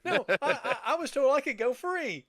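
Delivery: high, laughing prisoner pleading; laughing mock protest. Retelling the Brian sketch, laughing high prisoner's protest. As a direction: protest through laughter like the condemned man.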